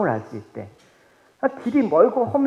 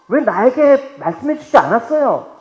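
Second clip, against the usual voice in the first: aggrieved plea; 6 semitones higher, 7 dB louder than usual. Acted. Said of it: "라고 그렇게 얘기할".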